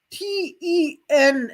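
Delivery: slow, letter by letter; spelling out letters. Shown as a demonstration. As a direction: spell each letter deliberately. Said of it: "Their name is spelled out with".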